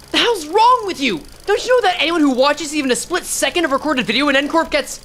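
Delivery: high, loud exasperated yell; angry shout. Acted. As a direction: yell at them in disbelief. Character yells at others.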